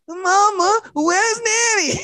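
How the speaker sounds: put-on high, slow little boy voice; small child. Little boy asking his mama for nanny, high and slow. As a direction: ask like a lost little kid.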